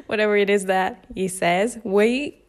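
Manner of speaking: normal talking voice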